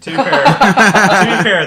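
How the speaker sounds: laughing through the words; slow, laughing high and loud. Real, not acted. Laughing while defending the joke.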